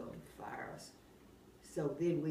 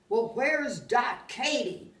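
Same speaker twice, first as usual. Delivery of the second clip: loud call; 6 semitones higher, 9 dB louder, slower than usual. Retold or imitated. Boss calling out for Katie.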